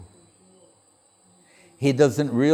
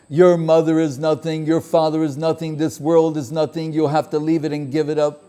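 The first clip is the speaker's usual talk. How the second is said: raised, emphatic preacher; preaching voice; 5 semitones higher, 3 dB louder than usual. Retold or imitated. Village preacher telling the boy the world is nothing, preachy. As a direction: lay down the hard truth like a sermon.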